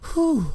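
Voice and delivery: high, loud puff of breath; relieved whew. Acted. Senator stops after four minutes of stabbing, big whew.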